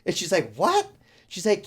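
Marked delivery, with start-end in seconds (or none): 0.5-0.9 high, louder mock-shocked question; retold astonishment. Woman reacting to her uncle's pregnant-tarantula claim, astonished yelp. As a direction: shoot the pitch up in imitated disbelief.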